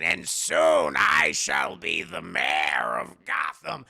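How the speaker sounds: low, slow shouted Penguin voice; deep gloating Penguin shout; Penguin impression. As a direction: bellow it deep like a gloating villain.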